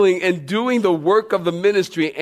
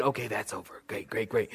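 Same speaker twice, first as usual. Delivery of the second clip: softer, relieved under his breath; relieved inner voice; 6 semitones lower, 12 dB quieter than usual. Retold or imitated. Guest at a dinner table, quietly relieved the sushi is gone. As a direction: mutter it to yourself in relief. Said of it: "I'm thinking".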